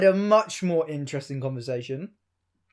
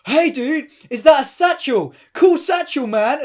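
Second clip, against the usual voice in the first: put-on much higher, louder, drunken bro voice; drunk stranger greeting; 7 semitones higher, 6 dB louder than usual. Drunk mate approaches a stranger in the street, high loud drunken greeting. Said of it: "walk up to a man and go".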